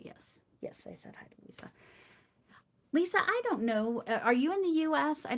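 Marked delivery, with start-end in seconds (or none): 0.0-1.7 fast hushed whisper, much softer; whispered aside. Real, not acted. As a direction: mutter it quickly under your breath. Quick aside confirming to herself.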